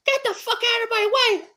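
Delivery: high, loud mocking squeal; mock angry lady. Retelling the lady's line in a high mocking voice. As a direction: ham up her outrage way up high. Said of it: "She goes".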